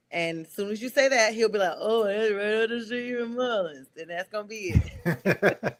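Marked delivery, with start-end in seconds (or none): 1.8-3.9 a hint of a Carolina country accent; impression of Xavier. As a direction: mimic a local Southern player with light mumbling. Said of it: "Your impression of, of him is quite good".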